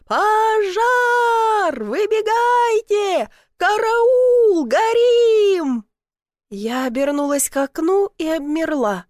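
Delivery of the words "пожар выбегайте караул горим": high, long-drawn shouts for help; yelling in alarm. A narrator's reading. Man warning everyone of a fire, yelling. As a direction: wail each alarm out loud and slow. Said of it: "орал Семён Петрович".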